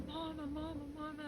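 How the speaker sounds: high, soft tearful calling; in tears. Retold or imitated. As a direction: imitate a crying daughter calling for her mother. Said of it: "I'm just in tears".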